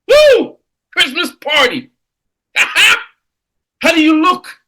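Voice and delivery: loud, high, gleeful visualizing of a party; excited. Pretend. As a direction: cheer as if already at the Christmas party. Imagining Christmas party excitement.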